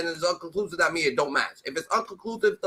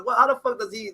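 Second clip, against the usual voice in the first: ordinary talk, nothing marked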